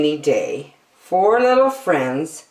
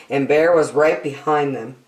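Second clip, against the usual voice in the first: ordinary delivery, nothing marked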